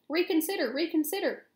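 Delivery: high, urgent goose appeal; insistent goose crying out; pleading cry. A narrator's reading. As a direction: beg him to think, pitched high. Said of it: "cried the goose".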